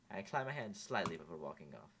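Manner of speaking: ordinary delivery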